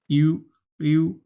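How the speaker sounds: slow, held "ইউ"; tongue drawn inward. Shown as a demonstration. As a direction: draw out "ইউ" with the tongue back. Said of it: "লেগে যাবে ভিতরের দিকে". (জিহ্বা ভিতরে রেখে "ইউ")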